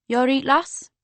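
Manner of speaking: a hint of a broad Geordie doorman; Geordie accent. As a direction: bouncer asking a girl at the door, in a light Geordie accent. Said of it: "said the bouncer in a strong Geordie accent".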